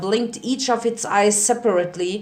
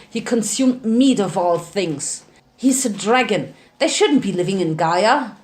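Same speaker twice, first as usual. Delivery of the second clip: plain reading voice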